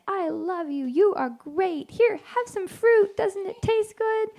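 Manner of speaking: high, drawn-out lovey-dovey voice; gushing sweetly in a high, slow singsong; sweet cooing. Acted. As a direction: coo it slowly and high, like offering treats. Shepherd voiced as wooing the bride.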